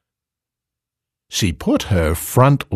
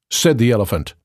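usual speaking voice

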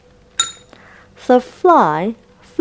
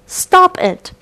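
shouted; 11 semitones higher than usual. Acted. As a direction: cry out to make him stop. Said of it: "shouted the Beaver".

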